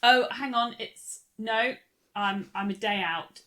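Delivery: ordinary delivery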